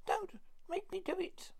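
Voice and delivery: high, pleading hag voice; witch voice. Acted. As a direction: beg like a terrified old crone. Frightened witch begs.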